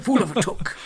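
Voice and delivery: high, loud mock wizard scolding; hammed-up wizard outburst breaking into laughter; Gandalf. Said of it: "do my bad Gandalf impression".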